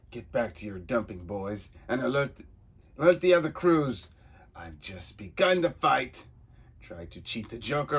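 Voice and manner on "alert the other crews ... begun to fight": rallying villain. Acted.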